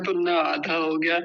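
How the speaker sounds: normal talking voice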